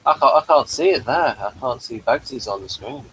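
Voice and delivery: ordinary talk, nothing marked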